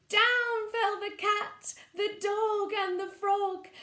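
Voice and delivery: lively storybook narration; reading to children. Acted. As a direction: read it like bedtime to little ones. Reading picture book aloud.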